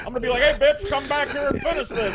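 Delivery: high, fast, giggly parody of a defiant crank; hammed-up defiant courtroom crank, breaking into laughs; mock sovereign citizen. Host acts a sovereign citizen defying the judge, high and giggly.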